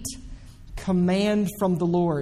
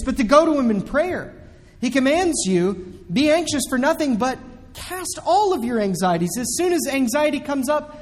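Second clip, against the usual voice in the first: rising sermon fervor, urging the listeners; fervent preaching; 5 semitones higher than usual. Acted. Preaching builds to full force.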